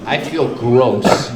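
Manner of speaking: low, sneering mock disgust; hammed-up revulsion at the applicant. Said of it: "where you go".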